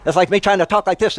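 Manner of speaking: fast, jittery high hobbit voice; wired hobbit voice. Shown as a demonstration. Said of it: "make him sound like a wired hobbit".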